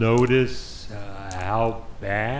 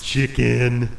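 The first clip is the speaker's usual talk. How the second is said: taunting; 6 dB louder than usual. Acted. Daring his brother.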